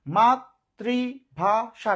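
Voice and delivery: মাতৃভাষা very slowly as "mat - tri - bha - sha"; four separated beats on মাতৃভাষা; syllable by syllable. Shown as a demonstration. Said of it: "কয়বার উচ্চারণ করলাম?". (মাতৃভাষা চার ভাগে, ধীরে ধীরে)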